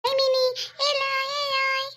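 put-on high, whining child's call; child calling the cat. Child calling the cat over, high wail.

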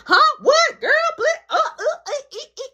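high squealing disbelief, laughing; high incredulous squeal breaking into laughter; shocked disbelief. Retold or imitated. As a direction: squeal it like you can't believe it.

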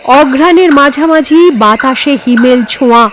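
ordinary delivery

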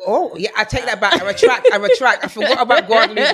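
loud, excited backtrack through laughter; laughing retraction. Real, not acted. Guest withdrawing her pick on remembering Guadeloupe, laughing and loud. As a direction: take it all back, loudly, laughing.

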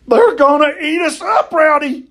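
high-pitched cry of alarm; scared yell. A narrator's reading. Boy warning Rowdy the monkeys will eat them, panicked yell. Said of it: "I yelled".

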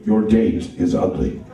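very low, slow butler voice; slow, deep English butler; Alfred the butler. Acted. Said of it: "he did sound like the butler from Batman".

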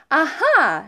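high, loud "aha"; eureka moment. A narrator's reading. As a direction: exclaim as if struck by an idea. Sudden idea while dialing.

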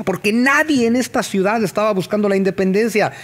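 ordinary talk, nothing marked

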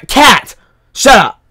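high, loud snapping at the cat; sudden loud outburst; startled yell. Real, not acted. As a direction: shout the cat down in alarm.

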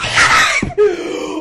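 high loud shrieking laugh. Real, not acted. Near miss reaction.

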